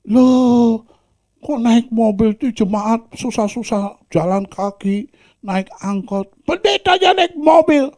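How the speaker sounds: high, loud complaining voice; indignant churchgoer. Acted. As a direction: grumble that the pastor drives. Churchgoer complaining about pastor.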